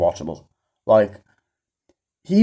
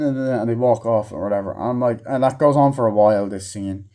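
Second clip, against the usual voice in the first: ordinary delivery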